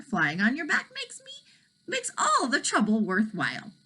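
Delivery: a hint of an eager young boy; lightly voiced boy. Elmer tells the dragon flying is worth it, lightly boyish.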